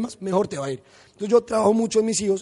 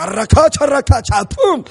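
mock magic spell; 5 semitones higher, 9 dB louder than usual. Imagined magic pastor spell.

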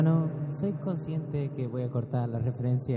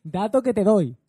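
mock announcer; 5 semitones higher, 7 dB louder, slower than usual.

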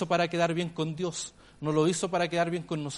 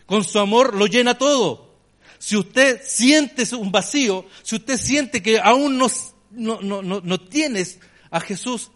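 louder, higher, slow impassioned appeal; preaching at full force; 5 semitones higher, 8 dB louder, slower than usual. Acted. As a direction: call the congregation forward with fervour. Preacher's altar call at full force.

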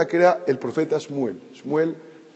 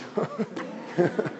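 a quiet, breathless laugh at his own punchline; breathy laugh; 2 semitones higher, 9 dB quieter than usual. Real, not acted. Preacher laughing at his own football joke, a quiet breathy laugh. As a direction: let a soft laugh slip out.

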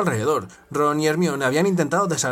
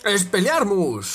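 higher, slow spell shout; 4 semitones higher, much slower than usual. Acted. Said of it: "gritó".

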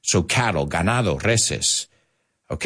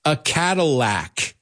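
slow punchline; 5 semitones higher, slower than usual. Shown as a demonstration. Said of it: "What is a cowboy's least favorite car?".

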